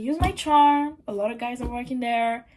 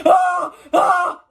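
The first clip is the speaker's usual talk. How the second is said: loud exasperated "ah, ah!" cry; sudden annoyed yelp; frustrated outburst; 6 semitones higher, 10 dB louder than usual. Real, not acted. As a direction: blurt out a fed-up shout. Exasperated outburst about Franklin.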